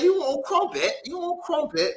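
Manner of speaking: lightly imitated British offer; mock British. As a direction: tease the Brits with it. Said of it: "I used to say".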